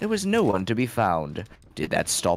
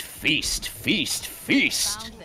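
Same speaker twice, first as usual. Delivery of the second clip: rising feast; 7 semitones higher, much slower than usual. A narrator's reading. Building repeated feast.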